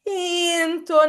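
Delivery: INTONATION sung out high and stretched; expressive intonation. Shown as a demonstration. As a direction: lift "intonation" high and draw it out to show a lively melody. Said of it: "not a monotonic voice without enthusiasm".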